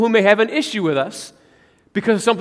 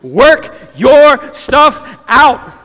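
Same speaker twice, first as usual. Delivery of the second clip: emphatic slow command; 3 semitones higher, 8 dB louder, much slower than usual. Real, not acted. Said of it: "emphatic command".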